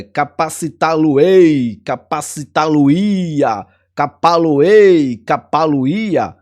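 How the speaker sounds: "capacitá-lo-ei", "capacitá-lo-ia" said slowly and grandly; slow formal forms. Shown as a demonstration. Teacher showing how formal mesóclise sounds, slow and grand. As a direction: savour each mesoclitic form, slowly and formally. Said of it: "Cap-, é".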